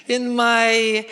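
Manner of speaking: usual speaking voice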